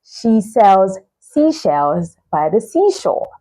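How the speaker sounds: "She sells seashells by the seashore" said slowly and carefully; slow, measured demo of the twister; slow tongue twister. Shown as a demonstration. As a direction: take each word of the tongue twister slowly. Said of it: "tongue twisters like".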